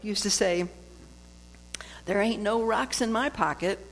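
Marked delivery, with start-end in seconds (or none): 2.0-3.8 Southern saying. Retold or imitated. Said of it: "another Southern".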